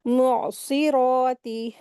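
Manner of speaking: "मुअस्सिराती" slow, throat catch after "मु", heavy s and long heavy "रा"; heavy-letter recitation. Shown as a demonstration. Teacher reciting the word, slow heavy-letter recitation. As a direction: draw out "मुअस्सिराती" with full-mouthed s and r. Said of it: "इसको heavy करके पढ़ेंगे".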